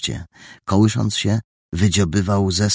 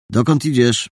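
raised, louder question; calling after someone; 7 semitones higher, 5 dB louder than usual. A narrator's reading. Young man to his departing companion, calling out. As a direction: call it out to a friend walking off.

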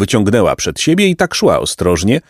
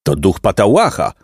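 ordinary delivery, nothing marked

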